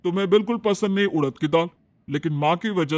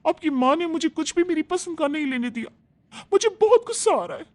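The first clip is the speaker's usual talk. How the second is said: much higher, fast angry complaint; angry wife; 10 semitones higher than usual. Acted. Wife complaining to husband about his mother, high and angry. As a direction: vent your fury at your husband. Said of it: "प्रिया बहुत गुस्से में होती है".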